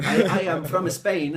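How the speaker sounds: SPAIN said as "eh-Spain", high and loud; hammed-up "eh-Spain" with an extra syllable; mock learner's extra E. Shown as a demonstration. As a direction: laughingly mimic a Spanish accent, "from eh-Spain".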